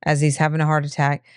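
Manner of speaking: ordinary talk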